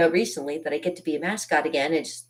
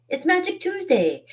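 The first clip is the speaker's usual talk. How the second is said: excited announcement. Real, not acted. Announcing Magic Tuesday to online viewers, excited announcement. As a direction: sing out the theme day much higher. Announces the show's weekly theme.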